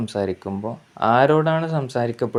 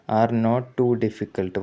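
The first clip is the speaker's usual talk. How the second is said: slow dictation; much slower than usual. Shown as a demonstration. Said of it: "teacher reads example slowly".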